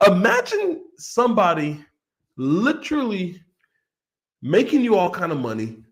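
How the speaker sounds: ordinary talk, nothing marked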